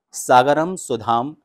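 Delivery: slow, loud, syllable by syllable "Sagaram Sudham मथनाति"; slow careful recitation. Shown as a demonstration. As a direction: recite it slowly to teach. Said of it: "वाक्य है".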